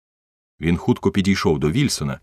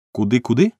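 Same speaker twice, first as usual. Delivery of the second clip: higher-pitched, pointed query; sharp question; 6 semitones higher, 3 dB louder than usual. A narrator's reading. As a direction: ask it sharply, voice lifted. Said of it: "гостро спитав полісмен". (гостре запитання)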